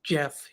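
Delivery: JEFF said as "jif"; soft G. Real, not acted. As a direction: say JEFF as GIF with a J sound. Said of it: "Do you say gif or gif".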